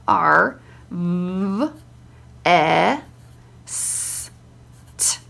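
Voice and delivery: R, V, E, S, T as held phonemes, long gaps; sounding it out. Shown as a demonstration. Child sounding out the word for mum, separated sounds. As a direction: say R, V, E, S, T one drawn-out sound at a time. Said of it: "sound it out for me".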